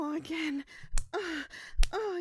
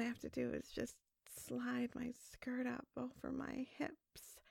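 whisper; 4 semitones lower, 6 dB quieter than usual. Acted. Seductive narration to lover.

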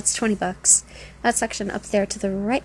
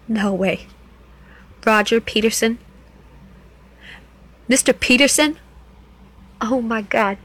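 louder, disbelieving cry of the name; stunned recognition; 6 dB louder than usual. Acted. Dancer realizing the customer is her old teacher, shocked outburst. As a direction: blurt his name out in shock.

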